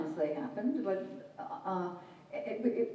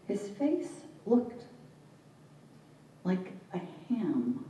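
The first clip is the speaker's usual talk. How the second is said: measured narrative verse with long pauses; poem reading. Acted. Poet recalling her drunk father to an audience, measured poetic reading. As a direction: break into short phrases, letting each image hang.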